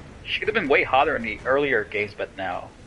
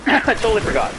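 louder, blurted out; loud exclamation; 9 semitones higher, 5 dB louder than usual. Real, not acted. Gamer blurting out his mistake to viewers, loud. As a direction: call it out as it hits you. Gamer realizing missed step.

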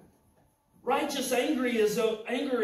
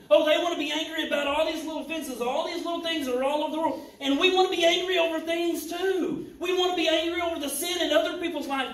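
fast, higher, louder preaching; 5 semitones higher, 5 dB louder, faster than usual. Acted. Preacher to church about petty anger, fast preaching. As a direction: rattle it off fired up.

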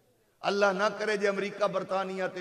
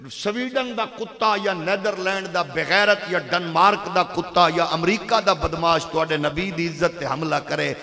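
loud, forceful sermon delivery; fiery preaching; 11 dB louder than usual. Real, not acted. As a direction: preach it at full force to rouse the crowd.

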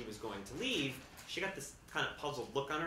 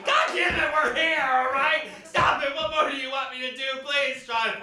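loud, high exasperated yelling; frazzled parent shouting; 13 semitones higher, 14 dB louder than usual. Retold or imitated. Parents shouting at kids in the line, loud and high. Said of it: "parents shouting".

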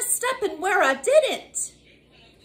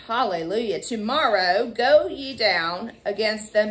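ordinary delivery

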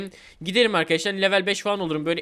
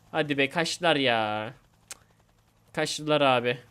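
low, subdued, resigned repetition; deflated disappointment; 4 semitones lower than usual. Real, not acted. Player realising the opponents have escaped, disappointed. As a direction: let the voice sink as hope goes.

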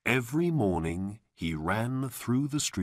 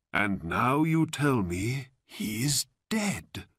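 lamenting, pitched up then sinking; grieving old man; 3 semitones higher than usual. A narrator's reading. Old man grieves to the boy, lamenting. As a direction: mourn aloud, a sigh before the end.

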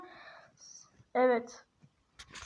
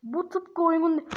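usual speaking voice

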